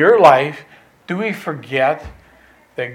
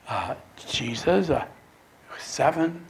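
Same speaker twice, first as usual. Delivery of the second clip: soft, halting answer with pauses; hesitate, then offer the answer meekly; sheepish; 7 dB quieter than usual. Acted. Disciples answering Jesus a second time, sheepish. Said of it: "probably sheepishly said".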